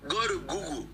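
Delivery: Google said as "Gu-gul" in a light put-on British accent. Shown as a demonstration. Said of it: "let me use the British accent".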